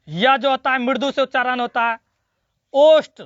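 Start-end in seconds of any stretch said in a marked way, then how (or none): 2.7-3.2 ओष्ठ called out loud; announced loudly. Shown as a demonstration. Teacher announcing the next group loudly. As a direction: call out ओष्ठ for the class. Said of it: "ओष्ठ से उच्चारण के लिए".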